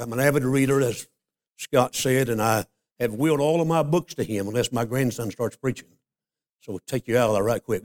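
usual speaking voice

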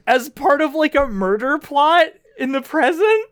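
rising, thrilled, nearly squealing; excited. Real, not acted. Thrilled about parents' secret plot.

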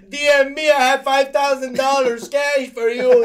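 high, loud, drawn-out put-on English; mock scam bot. Mocking the bots flooding his comments, high loud mock voice. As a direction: ham up a spam offer of cash. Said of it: "c'est comme".

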